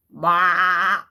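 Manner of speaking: sheep bleat; bleating baa. Shown as a demonstration. Reading animal sounds book.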